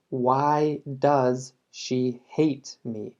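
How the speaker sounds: very slow, falling on hate; very slow classroom model, falling on hate; slow teaching read. Shown as a demonstration. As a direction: drag the sentence out for learners. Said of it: "Lo mismo acá".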